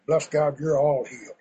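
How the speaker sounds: ordinary delivery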